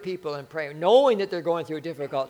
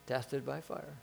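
much softer, murmured repeat; soft echo; 4 semitones lower, 14 dB quieter, slower than usual. Real, not acted. Preacher echoing his own verse, quiet and soft. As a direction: let it drop to a quiet echo. Preacher echoes the phrase softly.

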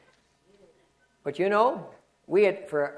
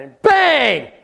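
a sudden shouted bang, much louder; 7 semitones higher, 10 dB louder than usual. Retold or imitated. Pastor telling how a car rammed them, shouted bang. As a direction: blurt the crash out like it just hit.

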